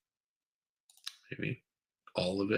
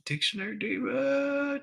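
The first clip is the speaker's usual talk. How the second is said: sing-song greeting. Real, not acted. Host greeting a chatter by name, sing-song call. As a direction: sing out the name, holding the last syllable high.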